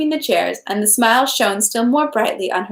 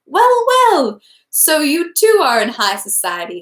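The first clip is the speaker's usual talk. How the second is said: pleased surprise; 7 semitones higher, 4 dB louder than usual. A narrator's reading. Prince greets his friend warmly.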